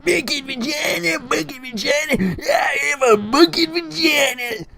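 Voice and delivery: very high, repetitive screaming; hammed-up squealing character screech; Jacqueline. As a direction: shriek it over and over with glee. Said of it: "Jacqueline starts screaming".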